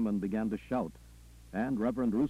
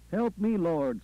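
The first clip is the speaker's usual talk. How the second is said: raised, higher cry for help; screamed plea; 7 semitones higher, 5 dB louder, slower than usual. A narrator's reading. Worshipper crying out during the sermon, a contained scream. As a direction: cry out to the Lord, short of a full scream. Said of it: "somebody screamed".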